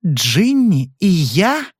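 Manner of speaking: slow rising echo of Джинни, unable to believe; wife can't believe her ears; stunned disbelief. A narrator's reading. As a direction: repeat it as if the words make no sense. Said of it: "повторила женщина, не веря своим ушам".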